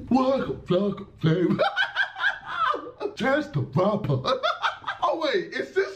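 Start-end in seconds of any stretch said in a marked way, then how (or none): 0.1-1.6 laughing, copying the sing-song; imitating fake Chinese. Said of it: "I'm just copying my man". 3.2-4.2 laughing, copying the mock tones; giggly sing-song copy; imitating fake Chinese. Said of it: "I'm just copying my man".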